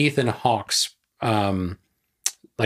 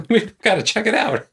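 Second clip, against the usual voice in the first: excited discoverer; 11 semitones higher than usual. Retold or imitated. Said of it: "being like".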